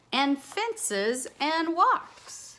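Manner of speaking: put-on lively rhyming read-aloud for kids; bouncy picture-book voice; reading to children. A narrator's reading. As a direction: read it like a storybook to a class.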